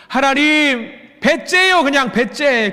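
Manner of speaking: high-pitched hammed-up defiance; defiant giving up. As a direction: whine at God that you quit. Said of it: "넘어져서". (높은 목소리로 배째라는 식의 투정)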